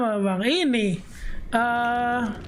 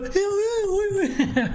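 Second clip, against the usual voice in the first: high laugh; 10 semitones higher than usual. Real, not acted. Laughing at story.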